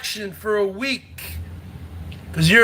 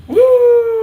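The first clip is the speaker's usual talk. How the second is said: high loud celebratory whoop; 11 semitones higher, 6 dB louder than usual. Real, not acted. Streamer cheering a donor, high loud whoop. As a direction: let out a big cheering woo.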